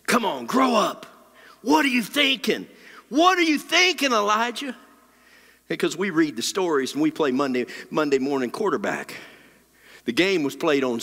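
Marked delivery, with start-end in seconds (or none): 0.1-4.8 high-pitched exasperated mock scolding. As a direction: scold him, pitch climbing with each question. Imagined God scolding Elijah.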